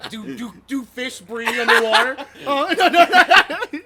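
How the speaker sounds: loud, high, laughing through the words; stretched-out words bursting with laughter; cracking up. Real, not acted. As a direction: barely get the words out for real laughter. Both cracking up at drunk joke.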